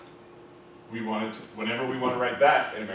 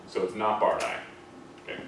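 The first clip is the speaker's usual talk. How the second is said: ordinary delivery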